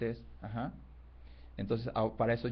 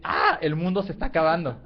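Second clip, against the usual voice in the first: grito fuerte y agudo de liebre asustada; da la alarma gritando, con la voz alta; 4 semitones higher, 8 dB louder than usual. Acted. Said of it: "Entonces grita y sale corriendo". (liebre que oye el estruendo, grito de alarma)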